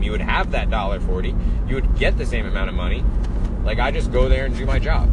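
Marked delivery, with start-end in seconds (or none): none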